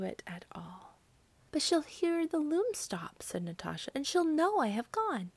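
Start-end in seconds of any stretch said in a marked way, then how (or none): 1.5-5.3 lightly voiced anxious girl, higher; worried girl. A narrator's reading. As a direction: raise the voice a little, like a worried child. Girl anxiously objects in story.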